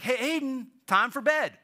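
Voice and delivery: high, loud bedtime call; parent calling child. Retold or imitated. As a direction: call a little kid to bed. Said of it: "So I'd be like".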